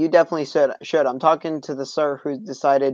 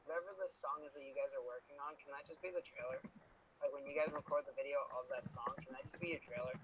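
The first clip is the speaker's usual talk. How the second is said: faint delayed echo of the voice; distant repeating echo far behind; 27 dB quieter, faster than usual. Real, not acted. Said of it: "Sorry about the echo here".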